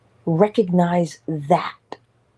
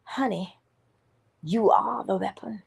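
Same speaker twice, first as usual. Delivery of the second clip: Dula's tone; 3 semitones higher than usual. Retold or imitated. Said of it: "in the tone of Dula, let me say it".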